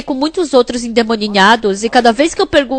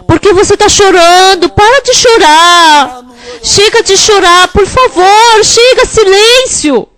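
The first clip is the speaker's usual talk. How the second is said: exasperated scolding; 5 semitones higher than usual. Retold or imitated. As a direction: beg her to stop crying, getting higher and sharper. Pastor scolding crying woman.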